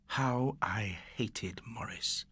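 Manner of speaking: slow, hushed whisper; bitter whisper. Acted. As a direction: breathe the hatred under your breath. Bitter thought after the beating.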